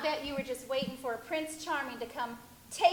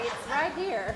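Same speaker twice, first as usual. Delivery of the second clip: ordinary delivery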